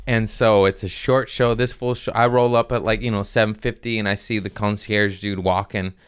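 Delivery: ordinary delivery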